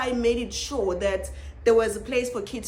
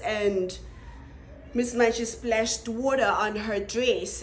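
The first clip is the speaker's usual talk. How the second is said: ordinary talk, nothing marked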